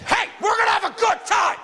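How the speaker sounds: high-pitched mock party shout; overeager partygoer. Party-town stranger greeting the comic, overexcited shout. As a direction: yell it like a manic reveler.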